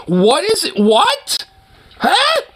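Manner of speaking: sudden high confused what; baffled. Real, not acted. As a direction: squeak out a bewildered huh. Baffled by a comment he reads.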